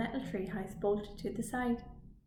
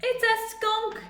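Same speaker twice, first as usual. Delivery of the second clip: high, louder yell of alarm; startled yell; 12 semitones higher, 5 dB louder, slower than usual. Acted. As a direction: cry out at the sudden skunk. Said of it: "yelled Bimbot".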